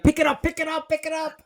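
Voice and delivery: high frantic pleading, each repeat fading; urgent excited plea. Retold or imitated. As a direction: beg them to grab the phone. Urging friends to answer phone.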